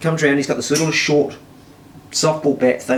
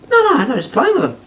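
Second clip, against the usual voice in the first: retold denial; 11 semitones higher than usual.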